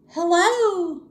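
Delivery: lightly voiced lazy bird calling; calling hello. A narrator's reading. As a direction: call out sweetly to the elephant. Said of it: "called the lazy bird".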